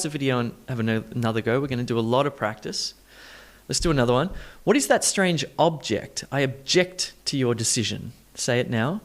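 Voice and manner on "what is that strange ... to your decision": OB-ject first, then ob-JECT; shifted stress. Shown as a demonstration. Teacher models another sentence, shifting stress. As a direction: shift stress in "object" from first to second syllable. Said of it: "doing some stress shifting first".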